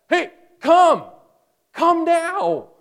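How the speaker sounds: high, loud urgent summons; excited shout of come now; emphatic call. Acted. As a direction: burst out calling them to come now. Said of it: "it's a very emphatic kind of explosion of, of language".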